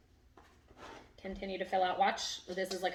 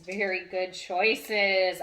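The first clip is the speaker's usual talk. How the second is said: louder, slowly stretched praise; 11 dB louder, much slower than usual. Real, not acted. Owner praising her dog, loud and slow.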